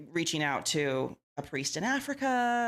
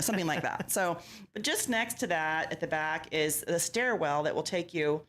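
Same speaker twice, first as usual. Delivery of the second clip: ordinary talk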